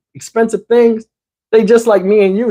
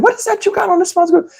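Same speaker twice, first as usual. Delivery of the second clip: impressed; 12 semitones higher than usual. Retold or imitated. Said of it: "I said".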